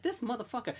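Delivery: high, soft mock outrage; retold flipping out. Keegan reacting to a text, mock outrage. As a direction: mutedly imitate someone flipping out. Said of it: "Keegan is just flipping out".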